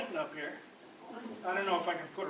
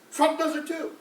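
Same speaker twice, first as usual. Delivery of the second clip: ordinary delivery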